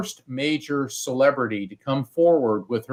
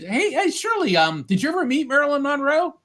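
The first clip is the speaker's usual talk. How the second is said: much higher, eager and excited; eager question; 13 semitones higher than usual. Retold or imitated. Mark eagerly asking Shirley on air, in a much higher voice. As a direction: ask it like a thrilled fan.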